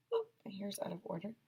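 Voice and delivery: quick whispered aside about her hair; a real whisper to herself. Real, not acted. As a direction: breathe it out off-mic, quickly. Teacher aside, hair undone.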